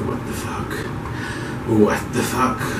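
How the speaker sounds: slow, breathy, barely voiced daze; coming off anesthesia, slow and barely voiced; groggy. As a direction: mumble it like you're just waking from anesthesia. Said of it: "You're all groggy".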